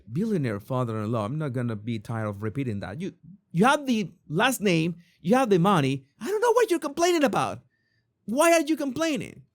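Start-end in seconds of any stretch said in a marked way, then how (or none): 6.2-9.4 high-pitched, exasperated outburst. Real, not acted. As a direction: demand it in high-pitched disbelief. Exasperated outburst at Beckham's complaints.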